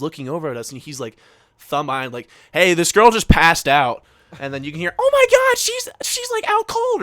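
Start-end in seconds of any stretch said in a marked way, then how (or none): 2.5-4.0 loud, drawn-out announcement; raised voice, mimicking a friend's alert; retold loud alert. Retelling friend announcing a fainting. 5.0-7.0 very high, loud mock shriek; hammed-up high-pitched crowd panic; panicked bystander.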